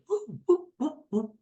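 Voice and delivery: high, swooping whoop whoop; playful high-pitched coaster cries; roller coaster whoops. Pretend. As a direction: ride the coaster with your voice.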